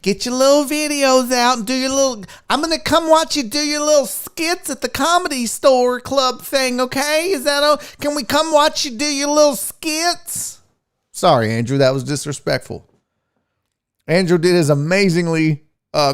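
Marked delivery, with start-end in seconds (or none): 0.0-10.5 high-pitched mock-mom voice; hammed-up mom impression, lilting higher; imitating his mom. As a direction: play a well-meaning mother, pitched up. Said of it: "I sound like my mom".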